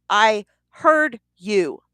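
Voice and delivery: slow, loud, each word hammered; emphatic insistence. Retold or imitated. Wife insisting to her husband she heard him, slow and emphatic. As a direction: lean hard on every word.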